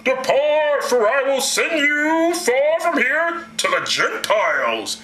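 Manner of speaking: high, slow, drawn-out proclamation; God voice. Acted. God sending Paul to the Gentiles, grandly intoned. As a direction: intone it like a mock almighty. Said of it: "This is my God voice".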